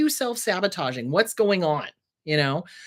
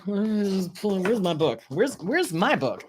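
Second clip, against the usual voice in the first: mimicking her dad; much slower than usual. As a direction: drag it out like a bumbling father. Mocking dad searching for book.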